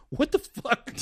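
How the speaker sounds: high-pitched, incredulous outburst; mock disbelief. Movie characters reacting to the hitchhiker's offer, mock disbelief.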